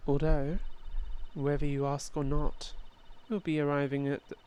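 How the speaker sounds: ordinary talk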